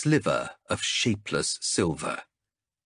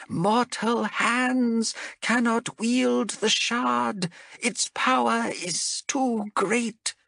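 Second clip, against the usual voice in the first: high, slow, strained creature voice; a dying lizard priest pleading slowly; wounded reptile priest; slower than usual. Acted. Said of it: "the wounded Saurian priest".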